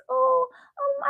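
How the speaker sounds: normal talking voice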